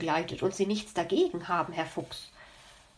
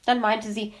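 ordinary delivery, nothing marked

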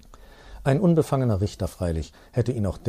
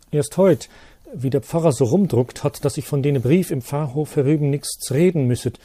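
higher, louder village postman, earnest dialect telling; old man blurting out his worry, raised voice; old postman confiding; 6 semitones higher, 5 dB louder than usual. Acted. Postman warning the priest about the teacher's letter, higher and louder.

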